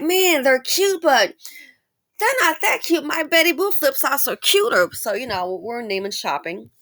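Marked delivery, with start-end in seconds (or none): 0.0-4.9 put-on teenage self. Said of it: "And I was like".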